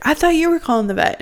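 high, fast, flustered retort; mock panicked boyfriend. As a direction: toss it back in a high, rushed panic. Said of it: "freaked out".

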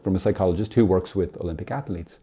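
usual speaking voice

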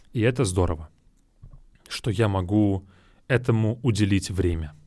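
ordinary talk, nothing marked

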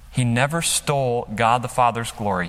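ordinary delivery

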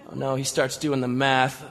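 usual speaking voice

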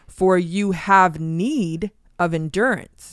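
slow phrase with "need" drawn out; stress on need. Real, not acted. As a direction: dwell on "need" before "of endurance". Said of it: "The key word I'm emphasizing".

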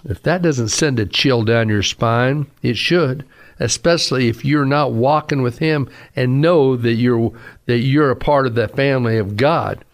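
ordinary talk, nothing marked

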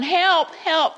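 half-hearted retold cry for help; howling children. Scared children in a rocking boat, lightly imitated howl. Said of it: "They were howling".

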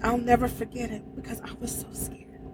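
near-whisper, fading away; soft, breath-heavy confession; hushed emotion. Real, not acted. Trucker remembering her fright on the ice, hushed emotion. Moved, recalling how scared she was.

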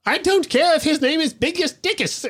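very high, comically strained imitation; film character impression. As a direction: mimic the actor's pompous line way up high. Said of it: "have the actor come out and go".

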